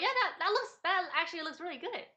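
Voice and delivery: bright excited approval. Retold or imitated. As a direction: gush over it happily. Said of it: "and I was like".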